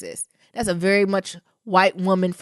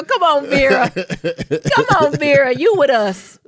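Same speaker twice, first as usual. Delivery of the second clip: loud, high, laughing appeal; playfully hollered plea; mock rallying plea; 6 semitones higher, 10 dB louder than usual. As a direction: call her over to your side, loud and high. Mock pleading to Verda, laughing.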